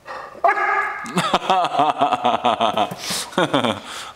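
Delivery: hearty loud laughter; long laugh. Real, not acted. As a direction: burst out laughing. Dog barks, owner laughs.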